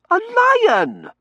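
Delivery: high, loud, affronted correction; indignant young lady's retort; outraged. A narrator's reading. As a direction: squawk it as if insulted.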